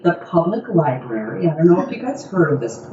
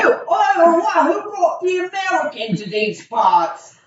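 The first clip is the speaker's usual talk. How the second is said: hammed-up peasant spotting an American; mock British outrage; 10 semitones higher than usual. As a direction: sputter in indignation. Improvised Renaissance fair scene.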